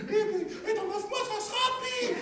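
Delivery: high, plaintive woman's voice, half crying; wife's high whining lament. Acted. Wife protesting to her husband, high and tearful. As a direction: whine and protest like a fretful peasant wife.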